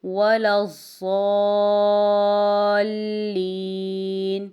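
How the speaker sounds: very slow tilawat, long held madd on الضالین; Quran recitation. Shown as a demonstration. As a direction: draw out الضالین melodically. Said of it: "next ہے". (ولا الضالین مد کے ساتھ تلاوت)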